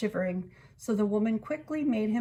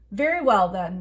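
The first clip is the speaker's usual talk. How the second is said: stern reply; 7 dB louder than usual. A narrator's reading. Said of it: "the king replied".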